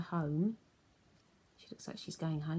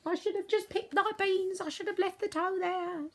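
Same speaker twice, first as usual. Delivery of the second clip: much higher frightened voice; scared woman; 13 semitones higher, 4 dB louder than usual. Acted. Frightened woman under covers, high scared voice. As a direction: squeak it out in regret.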